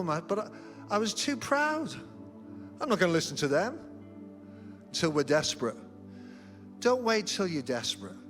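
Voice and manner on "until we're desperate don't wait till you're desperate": ordinary talk, nothing marked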